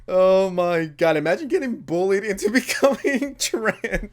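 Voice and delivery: talking through laughter; laughing. Real, not acted. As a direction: giggle through the words. Laughing at own joke.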